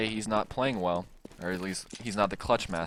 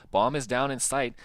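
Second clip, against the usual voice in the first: ordinary talk, nothing marked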